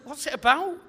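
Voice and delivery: a touch of defensive edge on the ask; defensive question. Retold or imitated. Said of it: "he got defensive".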